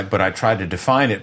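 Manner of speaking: usual speaking voice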